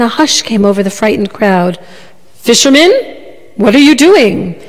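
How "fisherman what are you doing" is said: lightly voiced imperious prince; commanding. A narrator's reading. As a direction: demand an answer like royalty. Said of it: "in his deepest, most commanding voice".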